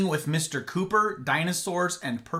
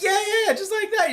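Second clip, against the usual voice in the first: mock eager agreement; 5 dB louder than usual. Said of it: "And they're like".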